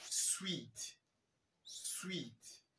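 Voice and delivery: "Sweet" said with a long ee. Shown as a demonstration. A teacher models "Sweet" for the class with a long ee. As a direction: hold the ee in "Sweet". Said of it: "Ça se fait de manière longue".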